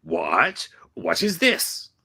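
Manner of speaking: confused, sharply rising question; baffled villain, pitch jumping up; surprised confusion. Acted. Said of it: "surprised confusion".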